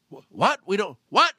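acted stunned reaction; baffled disbelief. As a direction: stop short in confusion. Boss stunned by the answer.

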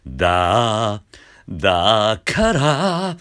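だから slid up out of a creak into pitch; だから with a fry scoop. Shown as a demonstration. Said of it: "しゃくるのが大事".

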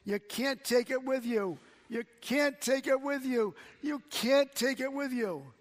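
loud, high, hammered preaching yell; excited revival-tent shout, repeated; evangelist yelling. Retold or imitated. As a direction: shout it at the crowd like a fired-up evangelist. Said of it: "he started yelling".

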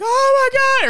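high loud mock shriek; yelling. Drunks panicking in the dark laser tag room, loud high shriek. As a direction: imitate a panicked group yelling.

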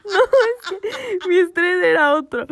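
high, loud, slow laughing protest; laughing through it. Real, not acted. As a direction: laugh while explaining the stress. Laughing over pandemic panic.

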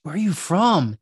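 whoa, acted-out astonishment; astonished stranger. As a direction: imitate someone whose mind is blown. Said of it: "it blew this guy's mind".

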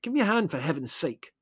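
indignant, urgent complaint; snarl. A narrator's reading. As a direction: snarl for help, exasperated. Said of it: "snarled Larry".

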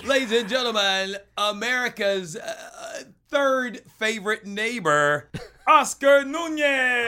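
loud booming guest introduction; announcer voice. Acted. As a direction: build it up like a ring announcer.

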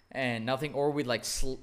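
ordinary delivery

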